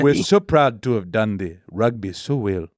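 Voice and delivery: put-on a light African accent, proud; proudly, in a light African accent.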